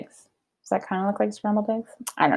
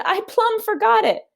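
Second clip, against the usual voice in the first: Amelia Bedelia voiced much higher; a high, eager maid's voice; 9 semitones higher than usual. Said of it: "said Amelia Bedelia".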